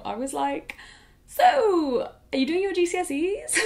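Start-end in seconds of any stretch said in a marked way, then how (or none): 1.3-3.6 much higher, louder chirpy deflection; awkward change of subject. Retold or imitated. She dodges the fan's question about her ex, high and chirpy. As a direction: brightly dodge the painful topic.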